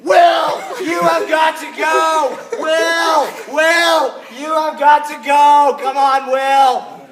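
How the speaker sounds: very high, loud frantic shout; Donna cheering Will on. Retold or imitated. Donna yelling at Will running past, very high and loud. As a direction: scream him down the course like a desperate fan. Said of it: "Donna Wiedeberg".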